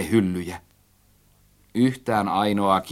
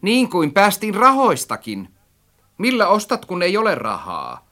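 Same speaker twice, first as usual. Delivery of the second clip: high, loud, spiteful jab; nasty taunting; 9 semitones higher, 3 dB louder than usual. A narrator's reading. Narrator needles friend about money, maliciously. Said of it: "sanoin entistä häijymmin".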